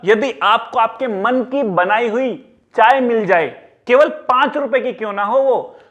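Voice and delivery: ordinary delivery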